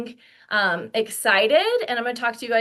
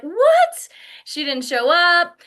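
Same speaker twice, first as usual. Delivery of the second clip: high, loud "What?" then indignant; incredulous outburst, pitched way up; shocked disbelief; 6 semitones higher, 5 dB louder, slower than usual. Acted. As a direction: react as if stood up. Acted reaction to a cancellation.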